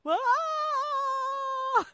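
high, loud scared scream; a terrified kid screaming; child's shriek. Retold or imitated.

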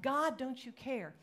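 restrained version of a despairing question; toned-down plea. Acted. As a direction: plead earnestly without shouting. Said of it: "But we do. We think that".

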